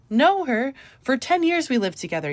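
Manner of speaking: high, loud disbelief; as if it's obvious; Know her, said high in amazement; incredulous. A narrator's reading. Incredulous reply about friend.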